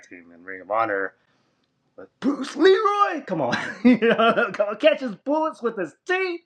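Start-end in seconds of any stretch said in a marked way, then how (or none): 1.9-6.4 giddy fan gush. Retold or imitated. Gushing about Bruce Leroy.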